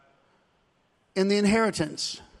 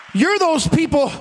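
usual speaking voice

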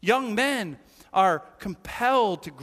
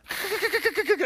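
high cackling giggle, much higher; imitated girlish giggle; 11 semitones higher than usual. Preacher mimics teenage girls, high giggling burst. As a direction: mimic a burst of teen-girl laughter.

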